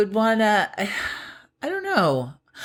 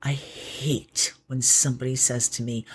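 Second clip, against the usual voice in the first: usual speaking voice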